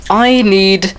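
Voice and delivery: high, slow plea; pleading hero. Acted. Pterodactyl asks his friends for help, slow high plea.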